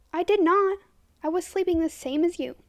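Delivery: high, loud indignant denial; shouted. A narrator's reading. Accused boy denying he came to the window, shouting. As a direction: raise your voice and deny it hotly. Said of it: "shouted Dorian".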